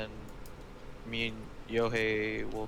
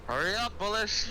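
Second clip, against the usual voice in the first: high, urgent in-character call; hurrying someone along from a distance; 9 semitones higher than usual. Acted. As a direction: call him over, pitched up high. Player calls to character in game.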